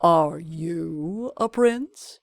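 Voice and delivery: slow, lordly sultan voice. A narrator's reading. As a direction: speak down from the throne, slowly. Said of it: "the Sultan said".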